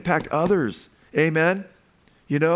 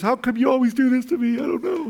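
teary quote; 9 semitones higher than usual. Retold or imitated. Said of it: "she start bawling".

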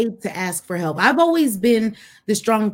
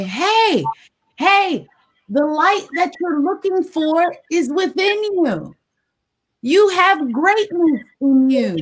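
Les Brown voice; 9 semitones higher, slower than usual. Retold or imitated. Voice from the tunnel, slow and uplifting motivational speaker voice. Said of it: "says Liz Brown".

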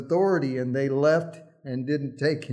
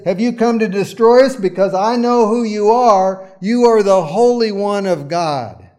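demon's cry, higher and louder; possessed man shouting at Jesus, higher and louder; 4 semitones higher, 7 dB louder than usual. Acted. Demon voiced crying out.

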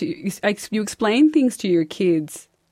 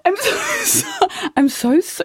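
much higher, slow, tearful stammered apology; flustered apology; 12 semitones higher than usual. Retold or imitated. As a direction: stammer out an embarrassed sorry. Flustered apology to another mum.

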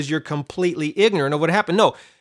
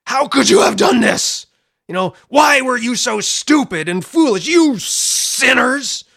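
mock angry lash out; 8 semitones higher, 6 dB louder than usual. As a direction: pretend temper tantrum, scolding the sinners. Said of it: "He doesn't lash out at them and say".

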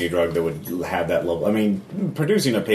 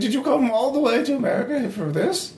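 very high, halting hostess voice; inquisitive mother character. Acted. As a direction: question guests in a high matronly voice. Game master plays Bertha.

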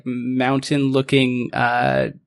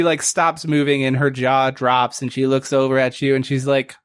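normal talking voice

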